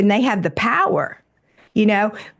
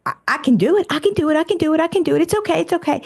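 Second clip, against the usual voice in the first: higher, eager voice insisting it can keep going; eager Energizer Bunny; 6 semitones higher, much faster than usual. Retold or imitated. Inner Energizer Bunny reassuring the tired worker, higher and eager. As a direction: chirp it out like an overeager inner cheerleader. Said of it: "Bunny says".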